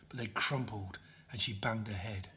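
usual speaking voice

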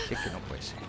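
very soft, voiceless whisper; whispered disbelief. Real, not acted. Muttering disbelief after a miss.

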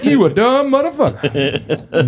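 laughing. Real, not acted. Laughing through the punchline.

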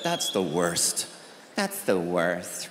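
low, slow mopey whine; mock whiny complaint. People overusing that phrase, a low slow mopey whine. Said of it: "heard people say that".

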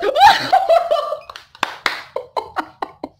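very high, loud shrieking laugh; long squeal of overjoyed laughter; real fan shriek dissolving into laughter; ecstatic shriek. Real, not acted. Overcome by favourite pull.

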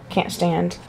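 ordinary delivery

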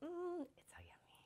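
breathy whispered relish over a bite; whispered yum. Real, not acted. Cook tasting food, whispered delight. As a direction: savour it in a whisper.

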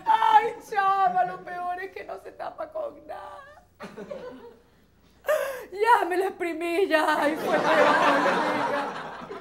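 high, drawn-out mock sobbing; hammed-up crying, stretched words; tearful teen wailing. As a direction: whimper and wail to a friend. Acted teenager wailing to friend.

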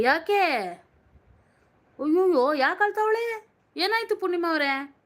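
high, startled questioning; oh no, what happened; surprised alarm. Real, not acted. Reacting to news of crying.

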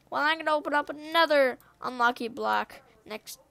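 normal talking voice

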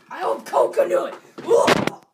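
loud hopeful cry; excited shout. Real, not acted. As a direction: shout it with hope. Reacting to a jelly bean pick.

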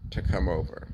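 ordinary talk, nothing marked

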